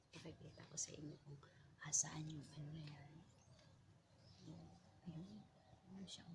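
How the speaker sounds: soft hushed whisper. Real, not acted. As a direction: whisper near the nest. Whispering near the nest.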